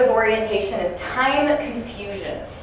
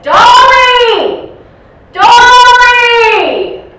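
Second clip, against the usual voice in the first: loud, high, drawn-out holler; shouting for Dolly; 17 dB louder, much slower than usual. Acted. Resident shouts down the hallway for his wife, shouting. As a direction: call the name out at full volume. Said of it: "He's shouting for Dolly".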